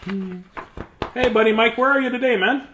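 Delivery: ordinary delivery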